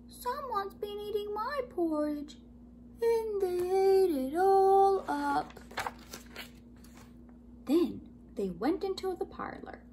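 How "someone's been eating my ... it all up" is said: wee small voice. A narrator's reading. Baby Bear finds his porridge all gone, high wee wail. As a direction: wail it like a little bear cub. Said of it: "wee small voice".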